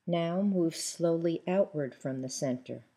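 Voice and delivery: usual speaking voice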